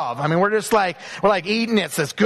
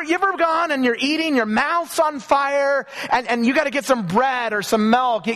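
ordinary talk, nothing marked

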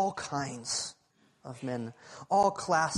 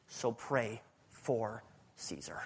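softer, slow whispered plea; whispered charge; 6 semitones lower, 8 dB quieter, slower than usual. Acted. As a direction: lean in and whisper the challenge. Closing point whispered to congregation.

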